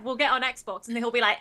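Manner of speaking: normal talking voice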